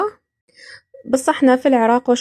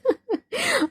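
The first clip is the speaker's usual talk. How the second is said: high giggle; 14 semitones higher, 7 dB quieter than usual. Real, not acted. Laughs after saying curse.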